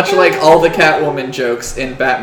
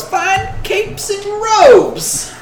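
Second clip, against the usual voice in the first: goblin seller; much slower than usual. Acted. Introduces goblin seller's cry.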